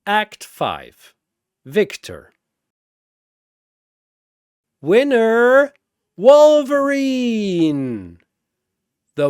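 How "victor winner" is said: plain reading voice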